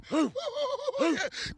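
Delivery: high, sudden burst of laughter. Real, not acted. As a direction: cackle with glee after the hype. Manager laughs after his line.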